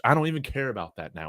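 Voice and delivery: ordinary delivery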